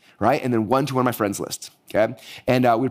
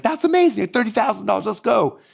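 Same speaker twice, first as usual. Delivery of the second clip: much higher, celebratory; pumped about the sales; thrilled; 10 semitones higher than usual. Retold or imitated. Celebrating thirty thousand in webinar sales, high and thrilled.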